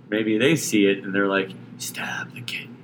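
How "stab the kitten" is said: hushed, whispered inner urge; whispered sinister thought. Acted. Host voices someone's dark thought on seeing a kitten, whispered. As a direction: whisper it like a creepy secret thought.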